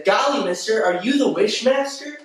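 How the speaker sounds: high-pitched, gee-whiz awe; naive innocent. Acted. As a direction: ask like a wide-eyed kid in a skit. Acts the naive mortal's setup line.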